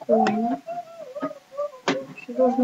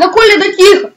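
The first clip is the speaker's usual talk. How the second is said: loud yelling at a friend; frustrated yell; 5 semitones higher, 14 dB louder, faster than usual. Real, not acted. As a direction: shout him down, pleading. Yelling at friend mid-game.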